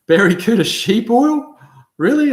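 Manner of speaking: high, loud incredulous question; can't believe what he just read; surprised disbelief. Real, not acted. Reacting to a surprising chat comment.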